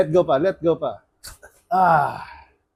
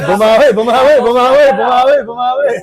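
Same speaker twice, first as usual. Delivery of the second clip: laughing cheer; 6 semitones higher, 10 dB louder than usual. Real, not acted.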